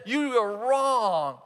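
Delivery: acted in-your-face scolding; confrontational accusation. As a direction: hammer each word slowly at them. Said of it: "Getting in someone's face and trying".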